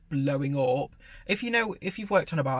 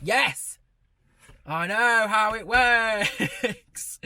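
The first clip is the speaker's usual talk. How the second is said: higher, drawn-out cry of frustration; exasperated yell; 6 semitones higher, 4 dB louder, much slower than usual. Retold or imitated. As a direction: stretch the words out in mock desperation. Acted exasperation at patronising manager.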